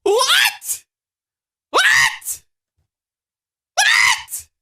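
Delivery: very high, squealed disbelief; incredulous squeal. Real, not acted. Viewer reacting to Elmo's threat, shocked high squeal.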